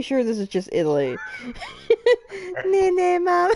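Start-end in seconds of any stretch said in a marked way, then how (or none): 1.4-3.5 crying with laughter; laughing to tears. Real, not acted. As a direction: laugh until it breaks into tears.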